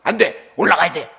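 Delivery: mock strict father barking orders; stern refusal. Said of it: "이러면 안 되고". (엄한 아빠처럼 단호하게)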